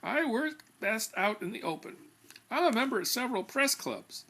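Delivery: a touch of smug pride; proudly. Acted. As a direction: brag about the press clubs. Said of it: "he said proudly".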